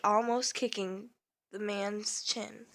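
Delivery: ordinary delivery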